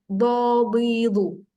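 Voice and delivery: "Dadidu" drawn out with a slightly lisped heavy d; very slowly, "Dadidu" with a thick side-tongue d; slow drill. Shown as a demonstration. Teacher modelling the dod syllables for the student. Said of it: "dia akan ada sedikit pelat".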